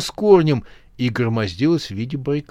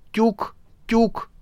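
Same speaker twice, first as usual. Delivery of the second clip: tuk tuk sound effect; 9 semitones higher than usual. A narrator's reading. Two brick hits.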